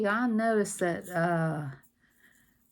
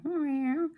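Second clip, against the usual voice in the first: cat meow; 8 semitones higher than usual. Retold or imitated. Imitating a cat.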